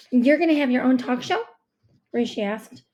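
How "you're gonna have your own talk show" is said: louder, excited question; eager guess. A narrator's reading. Rishi guessing at mom's new job, eagerly. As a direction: blurt out a hopeful guess.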